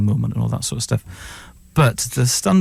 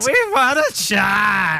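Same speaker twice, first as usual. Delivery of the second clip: mock John voice; 10 semitones higher, much slower than usual. Guest hamming up John's smug reassurance, high and slow. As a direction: drag it out high and pleased with yourself.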